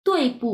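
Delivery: "তুই" high falling, then "পু" lightly released; neutral tone ending. Shown as a demonstration. Teacher shows neutral tone, "পু" touched and released. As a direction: let go of "পু" straight after "তুই". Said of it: "neutral টোনের মতো ধরেই ছেড়ে দিবেন".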